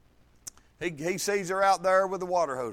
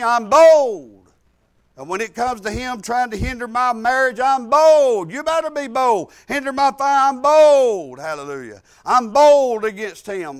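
fiery preaching; 7 dB louder than usual. Acted. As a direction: preach it hard to the congregation. Preaching at full force.

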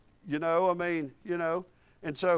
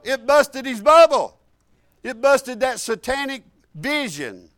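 raised, emphatic pulpit preaching; 3 semitones higher, 6 dB louder than usual. Acted. Preacher driving the point home to his congregation, preaching.

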